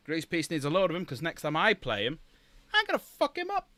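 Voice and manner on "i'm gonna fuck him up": high squeaky mock threat. Teasing Grease Peace about the next game, high mock threat. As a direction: joke menace at a rival player.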